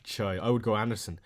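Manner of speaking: normal talking voice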